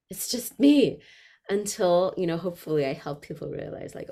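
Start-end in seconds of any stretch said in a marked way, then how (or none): 0.1-1.0 higher, louder frightened cry; a startled performer realizing she's alone; mock panic. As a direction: voice the terror of being exposed. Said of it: "And we think, like".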